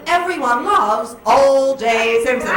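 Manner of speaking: loud, slow, chipper newsreel narration; hammed-up old-time newsreel voice; 1940s newsreel announcer. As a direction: sell a tragedy as a cheery outing. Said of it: "They'd be like".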